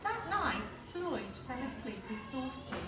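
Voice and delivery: plain reading voice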